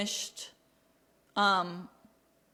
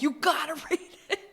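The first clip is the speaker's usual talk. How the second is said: urging friends while laughing; half-laughing, pushy insistence; laughing plea; 5 semitones higher than usual. Retold or imitated. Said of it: "I'm like".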